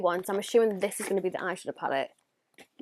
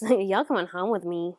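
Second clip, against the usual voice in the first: ordinary delivery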